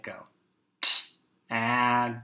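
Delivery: usual speaking voice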